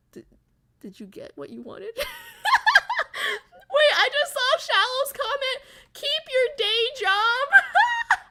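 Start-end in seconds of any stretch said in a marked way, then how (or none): 2.0-2.1 very high, loud, slow mock outrage; squealing outrage. Streamer reads a chat jab out in mock outrage. 2.1-3.4 very high, loud burst of laughter; high shrieking laugh. Real, not acted. Streamer cracks up after acting cute for the camera. 4.0-8.2 very high, loud, slow mock outrage; squealing outrage. Streamer reads a chat jab out in mock outrage.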